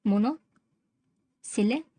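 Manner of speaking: "Monosyllabic" in slow chunks with long pauses; "mono", pause, "सिले", pause, "बिक"; slow, broken up. Shown as a demonstration. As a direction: dictate "monosyllabic" piece by piece. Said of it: "उसको monosyllabic कह सकते हैं".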